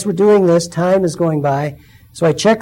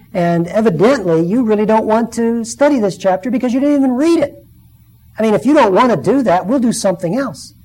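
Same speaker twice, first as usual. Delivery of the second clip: disgusted scolding; 6 semitones higher, 3 dB louder than usual. Retold or imitated. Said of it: "I got disgusted".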